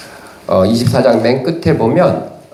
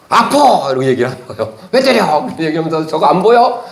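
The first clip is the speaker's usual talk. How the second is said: talking donkey; 4 semitones higher than usual. Acted. The donkey speaks in the story.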